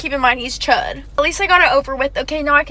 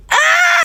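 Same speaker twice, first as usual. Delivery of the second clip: a sudden high shriek of outrage; outraged shriek; 13 semitones higher, 4 dB louder than usual. Retold or imitated. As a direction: let out a loud high yelp of disbelief. Reacting to his rudeness.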